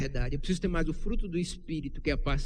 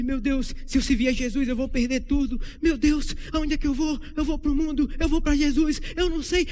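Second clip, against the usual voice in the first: higher, pleading voice of a torn believer; higher, fretful inner voice torn between the world and Jesus; anxious indecision; 10 semitones higher, 6 dB louder than usual. Retold or imitated. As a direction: act out the panic of someone caught between two paths. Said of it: "E não sabe".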